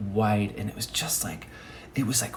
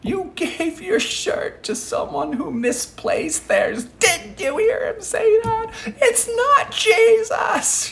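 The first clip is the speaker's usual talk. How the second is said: shrieking, crying falsetto of a scolding shirt; mock-tearful shrieking falsetto rebuke; shirt's wailing voice; 5 dB louder, slower than usual. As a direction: wail it very high as the shirt, half laughing. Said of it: "my shirt was like".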